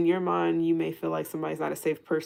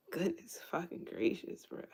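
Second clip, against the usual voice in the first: softer, breathy muttered disbelief; exasperated whisper; 8 dB quieter than usual. Real, not acted. Reader whispers to herself, fed up with guardedness. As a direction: whisper it like you're worn out. Exasperated aside after long rant.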